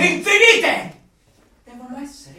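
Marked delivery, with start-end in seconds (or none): 0.0-1.0 high, loud, slow cry of infinite; fervent exclamation. Acted. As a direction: proclaim infinite like a revelation. Zealot completing the sentence emphatically.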